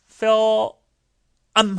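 film drawn out, "feee-o" then a closed "m"; film in slow parts. Shown as a demonstration. Teacher breaks film down for learners, slow and drawn out. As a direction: stretch the dark L in "film", then shut the lips. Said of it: "하고 입을 닫는다고요".

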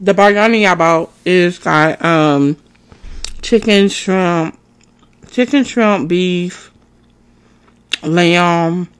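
chewing between words; eating while speaking; talking with mouth full. Real, not acted. Seen 4: Spoonful taken at -5; cheeks bulge, lips press between words throughout.

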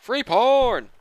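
high, loud cheer; excited call. Pretend. Player spotting free porn, excited cry.

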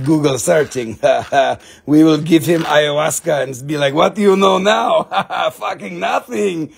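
gloating villain voice with cackling laughs; mock villain. Seller vows to kill the egos, mock villain gloating. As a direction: gloat like a cartoon villain, laughing between threats.

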